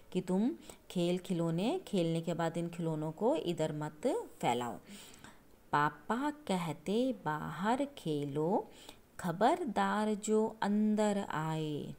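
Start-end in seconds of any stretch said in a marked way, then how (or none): 5.7-11.9 very slow, drawn-out reading of the verse; slow poem recital. Shown as a demonstration. Teacher reciting the father's warning from the poem, slowly. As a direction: stretch each word like a recited poem.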